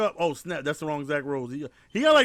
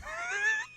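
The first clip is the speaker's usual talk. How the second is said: breathy wheezing cackle; wheezing laugh; 11 dB quieter than usual. Shown as a demonstration. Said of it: "demonstrate how to do the wheezy laugh".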